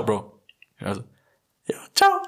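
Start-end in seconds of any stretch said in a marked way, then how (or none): 1.7-2.2 goodbye leaping into a very high, loud squeak; imitated voice crack. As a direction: let your voice crack wildly upward on the goodbye. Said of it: "Ich hab letztens wieder einen gehabt".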